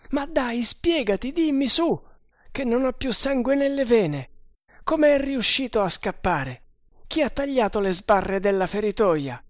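high-pitched, agitated pleading; frantic demand. A narrator's reading. Guard interrogates the freed captive about the escape, frantic. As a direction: press for answers, pitch way up.

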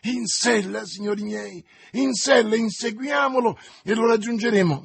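high, loud, urgent command; rally them to the chase; rallying shout. Acted. Young man urging his friends to ride, shouting.

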